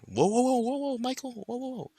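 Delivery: high, rapid string of whoas, loud at first; alarmed interjection. Acted. Narrator voicing himself grabbing Michael, high-pitched alarm. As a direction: yelp to hold someone back, then tail off.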